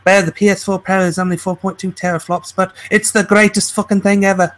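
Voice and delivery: mock pundit slipping into Scottish; accidental Scottish accent. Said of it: "I don't know why I'm Scottish".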